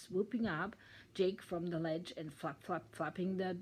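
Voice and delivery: ordinary delivery, nothing marked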